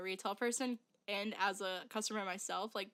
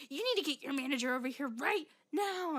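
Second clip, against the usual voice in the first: higher-pitched imitation of a demand; mock demanding customer; 4 semitones higher than usual. Customer demanding the manager, mock indignation. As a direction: imitate a worked-up customer giving orders.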